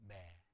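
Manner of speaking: "매" with a faint, soft vowel; weak "에" in 매. Shown as a demonstration. Teacher reads an opening shewa, weak "매". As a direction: say "매" low and soft, barely giving the vowel. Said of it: "약한 에 발음을 하는 거예요".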